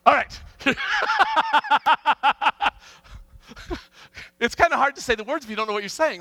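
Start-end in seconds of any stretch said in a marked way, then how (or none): 0.4-2.7 high, loud belly laugh; long helpless cackle of laughter. Real, not acted. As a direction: crack up completely. Cracks up at the mishap.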